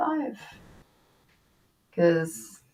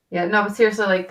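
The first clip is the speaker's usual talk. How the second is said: ordinary talk, nothing marked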